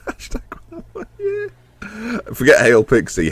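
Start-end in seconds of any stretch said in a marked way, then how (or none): 0.1-1.5 laughing wryly. Real, not acted. Laughing at himself.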